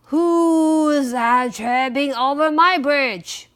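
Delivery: loud, slow, drawn-out troll challenge; troll's roar. A narrator's reading. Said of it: "roared the troll".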